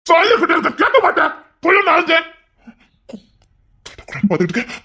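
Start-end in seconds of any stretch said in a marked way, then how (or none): 0.1-2.3 much higher, heated snap; husband losing his temper with his wife; angry outburst. Acted. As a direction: let the anger break out high.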